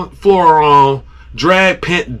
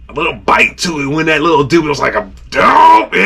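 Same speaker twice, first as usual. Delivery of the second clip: ordinary talk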